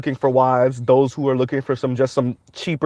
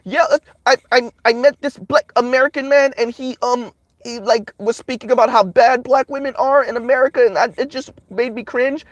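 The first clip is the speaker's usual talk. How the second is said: much higher put-on voice of a woman telling a made-up story; high mocking caller voice, sneering at the lie; mocking caller impression; 9 semitones higher than usual. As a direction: parody of a foreign woman caller, pitched way up. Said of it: "you can hear them making up stories and lying".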